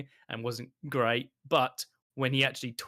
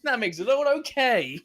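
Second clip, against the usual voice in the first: mock Toymaker; 6 semitones higher, 5 dB louder than usual. Fan quoting the Toymaker's smug line, high mocking tone. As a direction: sneer it out like a gleeful villain. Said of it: "the toy maker say".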